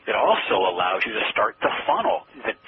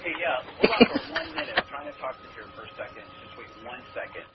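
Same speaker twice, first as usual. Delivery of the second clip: much softer and higher, turned away from the phone to an imaginary room; muffled side conversation; 8 semitones higher, 5 dB quieter than usual. Shown as a demonstration. Caller pretending to talk to a waiting group, quick muffled aside. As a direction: brush off people who aren't there, hurried and off-mic. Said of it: "Out of role play for a second".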